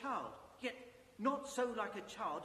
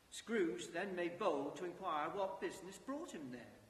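usual speaking voice

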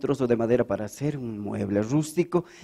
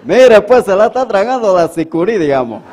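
loud, higher camba Bolivian; camba accent; 5 semitones higher, 9 dB louder than usual. Acted. Woodcutter explaining to the angel in a camba accent. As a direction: lean into the Santa Cruz lilt. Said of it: "A lo camba".

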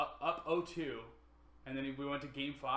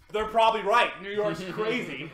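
louder and higher, half-joking "they're probably right"; mock excited outburst; 7 semitones higher, 11 dB louder than usual. Mocking himself after a crushing loss.

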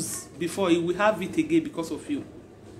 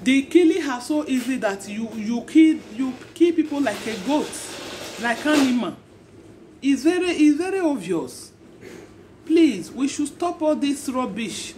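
raised-pitch sermon to the crowd, pleading; impassioned preaching; 5 semitones higher than usual. Acted. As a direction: preach it hard, pleading with them to stop. Preaching against killing.

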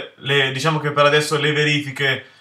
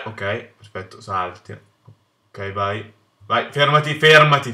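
normal talking voice

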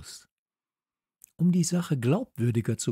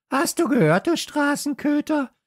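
high, louder jeering dog voice; taunting call; 6 dB louder than usual. Acted. Hasso jeers at the narrator dog, high and loud. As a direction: taunt the stray across the garden. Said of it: "rief Hasso mir zu".

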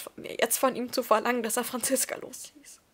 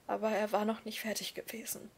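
ordinary delivery, nothing marked

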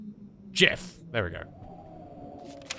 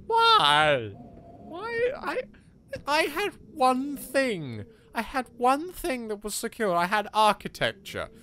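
mock anguish; 6 semitones higher than usual. Lamenting to the game that the door moved, hammed-up anguish. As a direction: wail like a betrayed lover.

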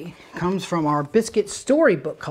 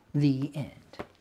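ordinary delivery

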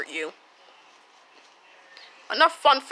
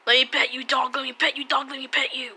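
usual speaking voice

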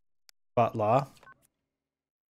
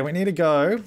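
ordinary talk

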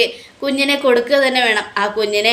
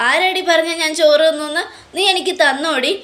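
higher-pitched, indignant challenge; angry accusation; 6 semitones higher than usual. Acted. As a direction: demand it crossly, as acted retelling. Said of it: "ദേഷ്യപ്പെട്ടുകൊണ്ടിരിക്കുക". (ദേഷ്യത്തോടെ ചോദ്യം ചെയ്യുന്ന അഭിനയം)

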